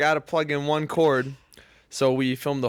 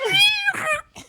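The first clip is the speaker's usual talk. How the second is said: very high imitated dying squeal; mole squeal; 4 dB louder than usual. Storyteller mimicking the mole's last noise, high mock squeal. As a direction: shriek like a squashed animal, mimicking. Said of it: "It goes".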